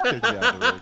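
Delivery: high, loud laughter through the words; laughing. Real, not acted. Recalling being thrown out of the ring, real laughter.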